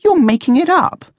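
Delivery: very high, scornful accusation; high-pitched mocking disbelief; sneering. A narrator's reading. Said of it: "Coco sneered".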